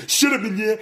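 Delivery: high, loud comeback; indignant retort. Acted. Actor answering criticism of his acting, indignant retort. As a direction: shut the critic down.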